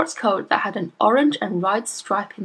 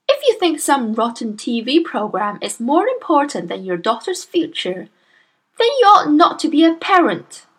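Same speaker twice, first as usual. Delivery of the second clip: losing patience; 7 semitones higher, 4 dB louder than usual. A narrator's reading. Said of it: "began to lose patience".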